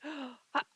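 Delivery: sharp gasp in, then held; quick audible inhale, cut off; demonstrated breath hold. Shown as a demonstration. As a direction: suck in air and hold it as a demo. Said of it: "like that, right?".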